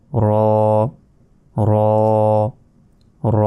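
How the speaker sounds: র as "rooo", rounded vowel held about a second; recitation-style long র, said separately; stretched letter. Shown as a demonstration. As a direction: draw out each র evenly on a level pitch. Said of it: "এক second টেনে পড়তে হবে".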